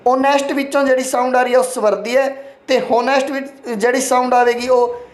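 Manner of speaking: usual speaking voice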